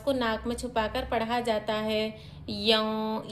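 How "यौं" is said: यौं with the n hidden in the nose, no clear n; correct nasal yaun. Shown as a demonstration. Teacher shows students the correct ikhfa, nasal yaun. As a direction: say यौं nasally, the n tucked away. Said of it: "यन नहीं पढ़ेंगे, यौं पढ़ेंगे".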